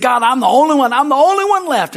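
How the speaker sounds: hammed-up pity-party complaint; crying out in self-pity. Preacher voicing Elijah complaining to God, loud self-pitying cry. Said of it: "he's crying out".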